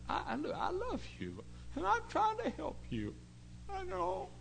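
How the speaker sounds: high, soft, pleading. Retold or imitated. Preacher pleading with the boy, high and gentle.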